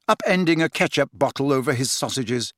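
ordinary delivery, nothing marked

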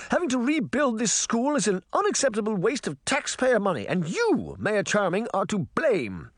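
high, imperious queen voice; haughty Queen of Hearts. Acted. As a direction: look down on the whole crowd. Queen scolds the mayor.

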